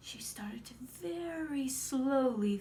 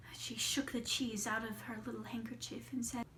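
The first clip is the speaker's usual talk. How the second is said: ordinary delivery, nothing marked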